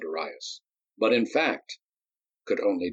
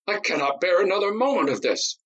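much higher, anguished and furious; desperate outburst; 11 semitones higher than usual. A narrator's reading. Nadi about to club Darius, desperately furious. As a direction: at the end of his rope.

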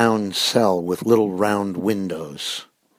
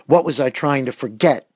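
restrained shout, pitched higher; 5 semitones higher, 4 dB louder than usual. A narrator's reading. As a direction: raise the voice into an exasperated demand. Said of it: "he shouted".

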